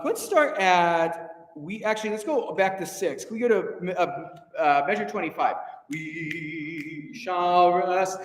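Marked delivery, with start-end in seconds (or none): 5.9-8.2 "We shall rest" drawn out very slowly, each word held; slow demo. Shown as a demonstration. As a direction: speak "We shall rest" very slowly as the choir did. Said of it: "this middle section is just too slow".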